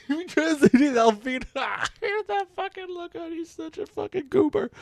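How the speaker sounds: high, loud, squeaky laughing talk; laughing hard. Real, not acted. Reader mocking a character's goofy look, laughing hard. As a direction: crack up while getting the words out.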